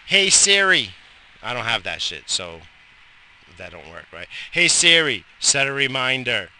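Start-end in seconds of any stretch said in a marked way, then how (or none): 0.1-0.9 calling out. Real, not acted. Calling the phone assistant. 4.5-6.5 calling out. Real, not acted. Commanding the phone assistant.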